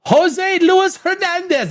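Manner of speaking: high, stretched delighted hello; light up and sing his name out; excited high greeting. Real, not acted. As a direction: streamer greeting his LA buddy in chat, thrilled. Greeting friend in chat.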